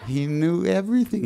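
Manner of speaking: high, laughing exclamation. Real, not acted. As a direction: burst into delighted laughter. Laughs recalling the banana.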